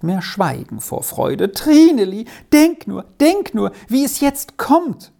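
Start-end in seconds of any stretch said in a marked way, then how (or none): 1.5-2.2 much higher and louder, calling out in joy; joyful cry. A narrator's reading. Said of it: "rief sie aus". 2.5-3.0 joyful exclamation. A narrator's reading. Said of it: "rief sie aus". 3.2-3.7 joyful exclamation. A narrator's reading. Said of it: "rief sie aus". 3.9-5.1 much higher and louder, calling out in joy; joyful cry. A narrator's reading. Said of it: "rief sie aus".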